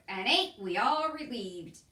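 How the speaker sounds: slow, loud, sarcastic drawl; sarcastic Cook. A narrator's reading. Cook mocks Mig's return with slow sarcasm. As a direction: drag it out with mock relief.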